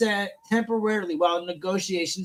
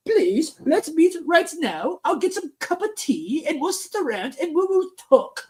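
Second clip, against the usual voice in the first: put-on wimpy European accent; 7 semitones higher than usual. Host mocks Europeans asking to meet, in a wimpy European accent. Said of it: "do a little pussy European fucking accent".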